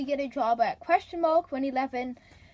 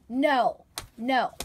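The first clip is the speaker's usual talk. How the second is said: ordinary talk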